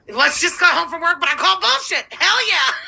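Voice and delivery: high, hyped-up chat read-out; gleefully hammed-up aggression, pitched high; aggressive entry. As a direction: read it out like a rowdy entrance. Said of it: "I like the aggressive entry".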